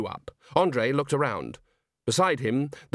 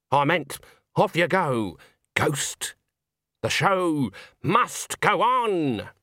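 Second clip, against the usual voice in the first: firm command; 5 semitones higher, much slower than usual. Acted. Granny orders the ghost off.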